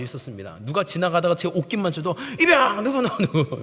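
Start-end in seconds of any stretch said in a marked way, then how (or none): 2.4-3.6 high, loud shout of a startled army private; jumpy rookie soldier's yell; shouting recruit. Retold or imitated. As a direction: shout your rank in a panic. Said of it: "막 외치면서 했습니다".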